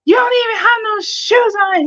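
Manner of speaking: squeaky high-pitched mock of her man; a high, teasing take on her boyfriend's scolding; imitating boyfriend. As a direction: pitch way up to play the nagging boyfriend. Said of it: "He was like".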